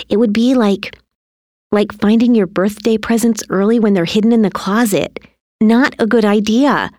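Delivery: lightly voiced mother, coaxing; earnest mother. A narrator's reading. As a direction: explain anxiously to a child. Mother explaining to son.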